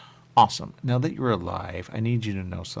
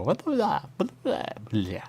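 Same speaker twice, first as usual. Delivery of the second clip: high, odd mock gibberish; 8 semitones higher than usual. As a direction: babble it in a strange high voice. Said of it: "We go".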